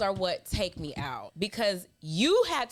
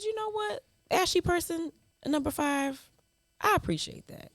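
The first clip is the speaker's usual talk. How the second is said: much higher, sugary fake-polite reply; mock gracious; 9 semitones higher than usual. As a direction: kill them with sweetness. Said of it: "but I said".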